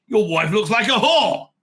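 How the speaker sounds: provoking a Gentile with a loud, slow insult. Retold or imitated. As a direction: throw the insult hard. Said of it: "if you say to a Gentile".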